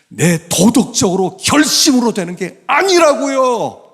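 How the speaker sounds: high, loud impassioned exhortation; full-force preaching. Real, not acted. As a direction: thunder it at the congregation.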